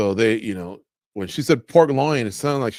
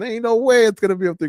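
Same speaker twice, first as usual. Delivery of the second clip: higher, louder and fast, teasing; playful disbelief; 11 semitones higher, 6 dB louder, faster than usual. Retold or imitated. Game show host teasing a contestant, playful higher disbelief. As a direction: rattle it off like a laughing host sure it's wrong.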